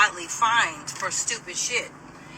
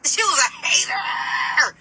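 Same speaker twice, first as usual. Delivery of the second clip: slow, loud, higher, drawn out; indignant shout; 6 semitones higher, 4 dB louder, slower than usual. Real, not acted. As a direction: call her out, drawn out and loud. Venting about the guard.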